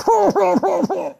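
very high hooting laugh; imitated cackle. Woman sitting on the boy's back, high cackle. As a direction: mimic her relentless laughter. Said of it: "And I'm just hearing".

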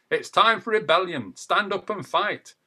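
rousing protest shout; rallying cry. Acted. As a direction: stir the crowd to fight. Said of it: "rallying call to rebellion".

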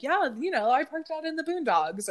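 imitating friend confidently saying "boondogs"; imitating ditzy friend. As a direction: raise your pitch and toss off "boondogs" confidently. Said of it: "She's like".

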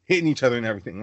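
normal talking voice

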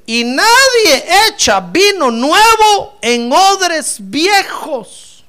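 versículo fuerte, agudo y lento; proclamación bíblica. Acted. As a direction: proclamar alargando cada frase. (lectura de predicador a plena voz)

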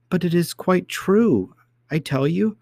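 high, drawn-out insistence; protesting child princess. A narrator's reading. Princess to nurse who doubts her, high protest. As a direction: plead in a high little girl's voice.

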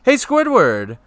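high, loud cartoon holler; calling out. Patrick calling Squidward as a new best friend, imitated high holler. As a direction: hail a neighbour in a mock-excited shout.

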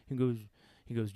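usual speaking voice

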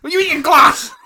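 high, frantic yell at a dog; loud, high-pitched panicked telling-off; mock dog-scolding shout. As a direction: re-enact shouting at a dog with its mouth full. Re-enacts scolding dog eating something.

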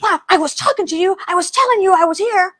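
high, loud indignant retelling; mad delivery man. Retold or imitated. Startled delivery man scolds the secretary, indignant. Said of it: "he got mad at me".

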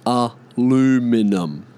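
slow, broken-up "Aluminum", a-loo-mi-num; American pronunciation. Shown as a demonstration. Showing how they hijacked the word, American pronunciation. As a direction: spell out "Aluminum" syllable by syllable, American style. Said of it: "hijacked the word".

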